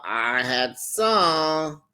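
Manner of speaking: usual speaking voice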